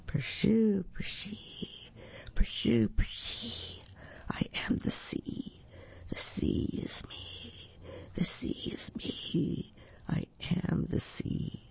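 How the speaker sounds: soft, whispery waves lulling someone to sleep; hushed sea. A narrator's reading. Little shell sings the sea to Goldilocks, soothing whisper. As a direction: whisper it slowly like a gentle sea.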